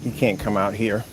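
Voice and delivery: ordinary talk, nothing marked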